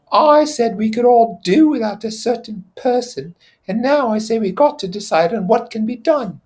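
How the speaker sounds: put-on high, loud confident boy; pleased to lead the plan; Piggy's voice. Piggy laying out the plan to the assembly.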